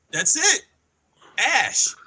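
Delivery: sudden high exclamation of discovery; excited eureka. Retold or imitated. Husband announcing the brand name to his wife, excited. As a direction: blurt out the idea, thrilled.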